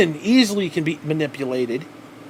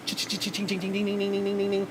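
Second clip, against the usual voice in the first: chat notification chimes; 3 semitones higher, 7 dB quieter than usual. Retold or imitated. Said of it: "it was like".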